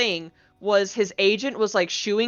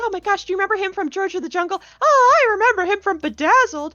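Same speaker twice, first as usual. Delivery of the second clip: very high, gushing squeals, imitated; fangirling out; 12 semitones higher, 4 dB louder than usual. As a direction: mimic fans topping each other excitedly. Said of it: "We got to fangirl out".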